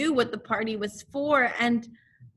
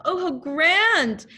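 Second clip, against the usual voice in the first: high, loud, slow delight; 7 semitones higher, 6 dB louder, slower than usual. Acted. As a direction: savour the grand news. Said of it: "thought Pooh to himself".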